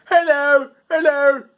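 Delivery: high, loud, dopey British voice; Yellow Guy. Shown as a demonstration. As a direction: call it out high and dim-witted. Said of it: "you gotta be like".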